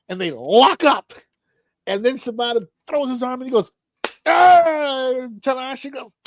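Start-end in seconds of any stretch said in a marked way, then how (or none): 4.3-5.1 loud, high, held yell; imitated battle yell. Retelling wrestler throwing his arm, high imitated yell.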